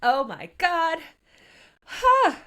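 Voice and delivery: high, loud thrilled exclamations; giddy excitement. Real, not acted. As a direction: let the excitement burst out high. Host reacting excitedly to the scene.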